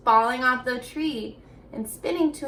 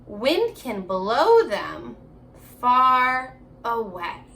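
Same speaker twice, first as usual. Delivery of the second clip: slow, deliberate picture-book reading; unhurried storybook voice for young listeners; reading to children; 4 semitones lower, much slower than usual. Acted. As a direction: read it slowly to little kids. Reads the picture book aloud.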